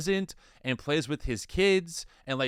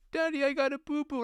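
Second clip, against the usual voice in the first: high-pitched imitation of a small son; imitated little kid voice; little boy; 11 semitones higher than usual. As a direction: pipe up like a little kid with a scrape. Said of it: "Our little guy, he goes".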